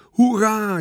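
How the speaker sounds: high-pitched crowd cheer. A narrator's reading. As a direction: cheer like a delighted spectator. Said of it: "juichten de toeschouwers".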